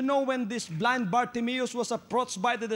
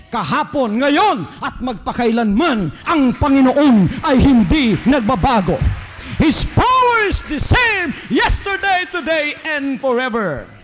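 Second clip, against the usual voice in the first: preaching shout; 3 semitones higher, 10 dB louder than usual. Acted. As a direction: proclaim it at the top of the voice. Climax of sermon declaration.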